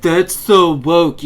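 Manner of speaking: slow, loud sneering impression of an anti-woke guy; mocking quote. Mocking anti-woke people's catchphrase, slow loud sneer. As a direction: drag it out loud with a sneer, stressing so. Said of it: "I heard something similar all the time".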